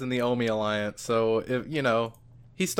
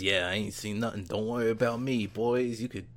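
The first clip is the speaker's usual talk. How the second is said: lightly voiced tough guy, softer; imitating Majima; 5 dB quieter than usual. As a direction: wave the boys off casually. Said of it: "He says".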